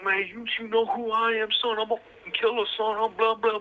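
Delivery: high, put-on swaggering voice, imitating; mock a loudmouth bragging he's a killer; tough-guy boast. Quoting New York boasting.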